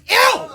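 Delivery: very high, loud laughing "Ew!"; disgusted squeal. Retold or imitated. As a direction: shriek in disgust through a laugh. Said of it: "I was like".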